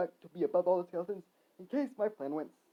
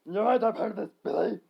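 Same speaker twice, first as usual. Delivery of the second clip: moderate raised-voice yell; 2 semitones higher, 6 dB louder than usual. Acted. Perry shutting Billy out, yelling. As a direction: snap it loudly. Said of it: "Perry yelled".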